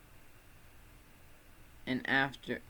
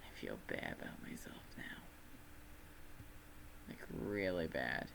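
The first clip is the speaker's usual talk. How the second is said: whisper; 11 semitones lower, 12 dB quieter than usual. Real, not acted. Self-deprecating whispered aside.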